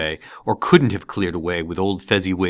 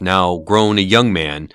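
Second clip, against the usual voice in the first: usual speaking voice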